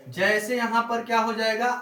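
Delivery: ordinary talk, nothing marked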